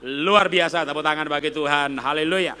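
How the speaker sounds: loud call for applause; rousing hallelujah; rousing praise. Real, not acted. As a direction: lift the room up. Preacher rouses applause.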